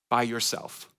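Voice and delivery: ordinary talk, nothing marked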